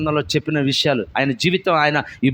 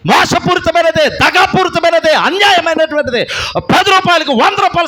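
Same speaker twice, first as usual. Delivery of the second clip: higher, louder preaching at full force; fiery preaching; 12 semitones higher, 7 dB louder than usual. Real, not acted. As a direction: exhort the congregation with fire. Preaching against Zacchaeus' past.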